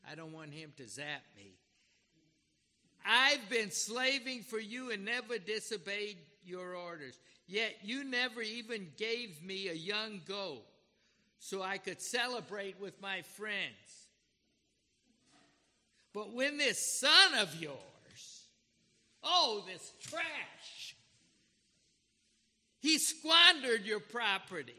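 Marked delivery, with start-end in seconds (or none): 3.0-14.1 loud indignant complaint, voice rising; angry older son. Acted. Older son complaining bitterly to his father, loud and indignant. 16.1-24.7 loud indignant complaint, voice rising; angry older son. Acted. Older son complaining bitterly to his father, loud and indignant.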